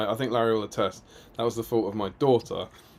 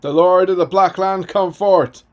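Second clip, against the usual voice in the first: put-on Irish accent; 9 semitones higher, 10 dB louder than usual. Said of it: "should be said in a slightly Irish accent".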